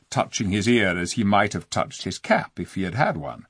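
ordinary delivery, nothing marked

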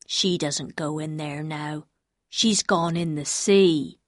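slow, low, dim-witted man; low, plodding voice of a childlike man; simple-minded man. Acted. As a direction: speak slowly as a simple fisherman.

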